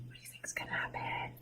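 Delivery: put-on hushed suspense whisper to the kids. A narrator's reading. Teacher asks the children what happens next, in a whisper. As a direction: whisper it like a secret.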